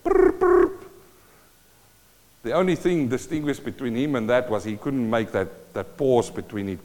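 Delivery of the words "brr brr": high trilled ring-ring; parrot phone ring. Retold or imitated. Parrot copying the phone ring, high trill. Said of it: "make the noise of the phone".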